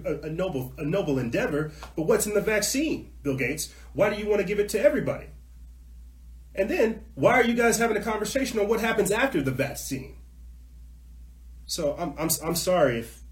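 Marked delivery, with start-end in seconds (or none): 2.0-10.2 high-pitched, exasperated demand; flying off the handle. Real, not acted. Host challenging Bill Gates over the vaccine, worked up. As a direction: fire indignant questions, pitch jumping up. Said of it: "I'm sorry if I kinda fly off".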